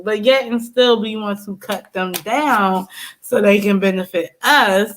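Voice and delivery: usual speaking voice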